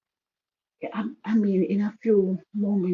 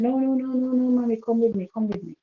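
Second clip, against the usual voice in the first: higher, louder frightened begging; cling to mommy and beg her to come; mild imitation of a scared little girl pleading; pleading child; 7 semitones higher, 3 dB louder than usual.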